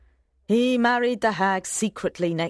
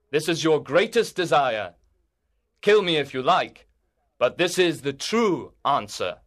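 a knight declaring boldly to court; earnest knight; 2 semitones lower than usual. A narrator's reading. Voices the knight.

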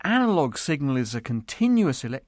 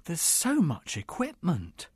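very soft, amazed murmur; awed whisper; 3 semitones lower, 10 dB quieter than usual. Acted. Newcomer marvelling at the equipment, whispering. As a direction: keep it hushed and full of wonder. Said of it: "whispered Simon".

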